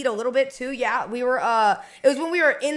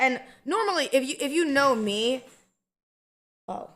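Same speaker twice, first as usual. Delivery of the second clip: ordinary talk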